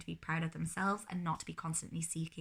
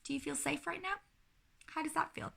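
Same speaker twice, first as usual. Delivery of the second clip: gentle check-in; 9 semitones higher than usual. Shown as a demonstration. Parent asking climbing child if he feels safe, gently. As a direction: ask kindly, trusting the child. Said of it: "I'll say".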